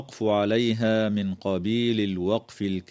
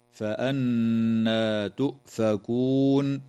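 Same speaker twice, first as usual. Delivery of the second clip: slow drawn-out recitation of فأنى تؤفكون; much slower than usual. Shown as a demonstration. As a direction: draw out the نّ of فأنى with ghunna. Recites verse.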